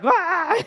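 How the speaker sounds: loud, high mock sob; imitated crying wail. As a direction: imitate someone overcome with tears, one loud wail. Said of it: "you could tell he was crying".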